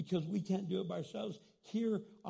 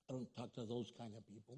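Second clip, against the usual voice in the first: low, much softer, quick brush-off; fast snobbish mock voice; 9 semitones lower, 11 dB quieter, faster than usual. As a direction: rattle it off like a snob.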